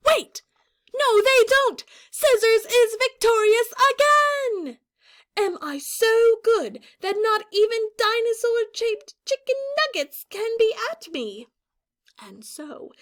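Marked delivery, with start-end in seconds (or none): 0.1-4.8 high, loud victory call; hammed-up triumphant correction; excited announcer. A narrator's reading. 5.4-11.5 put-on higher voice of a boastful girl warrior; lightly voiced proud heroine; Scissors' higher voice. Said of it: "use a higher voice because it's a girl".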